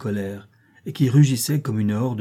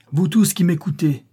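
raised, higher call to a crowd; crying out; 6 semitones higher, 4 dB louder than usual. A narrator's reading. Wounded officer addressing the hostile crowd, crying out. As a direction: call out to everyone listening. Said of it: "cria encore l'officier".

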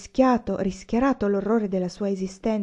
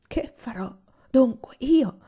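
sobbing; 5 semitones higher, slower than usual. A narrator's reading. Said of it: "Ella singhiozzò".

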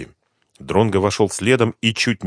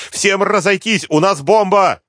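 loud, very high warning cry; urgent alarm, voice pitched right up; shouting; 12 semitones higher, 5 dB louder, slower than usual. A narrator's reading. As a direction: scatter the crowd with a shout. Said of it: "кричала Суслова".

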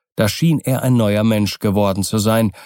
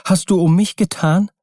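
high, astonished cry from Kokua; exclaimed question; 9 semitones higher than usual. A narrator's reading. Kokua learns what Keawe did for her, exclaiming. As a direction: raise the pitch in moved disbelief. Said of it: "rief sie".

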